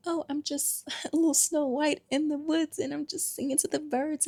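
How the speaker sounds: high, airy fairy-tale princess voice. Retold or imitated. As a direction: parody a sweet dainty innocent. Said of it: "Society rewards people who look healed".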